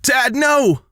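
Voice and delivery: very high scream held back in volume. Acted. Daughter sees father grab detonator, high contained scream. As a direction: cry out high in horrified protest. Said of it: "screams Ursi".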